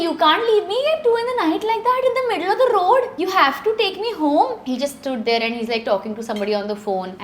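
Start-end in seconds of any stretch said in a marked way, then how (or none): 0.1-4.6 high, loud retold yell at the cab driver. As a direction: yell at him not to leave her on the road at night. Stranded at night, arguing with driver.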